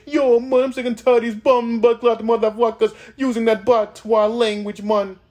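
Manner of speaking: mock Jamaican voice. Rapper imagining a Jamaican complaining about patois, Jamaican accent. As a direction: play a fed-up Jamaican man, Jamaican accent. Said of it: "You don't see no one say".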